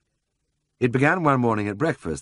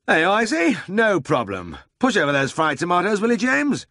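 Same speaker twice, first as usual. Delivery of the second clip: high, lively voice for the bustling boss; brisk Siegfried; 12 semitones higher, 4 dB louder than usual. A narrator's reading. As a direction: play the eager older brother full of a plan.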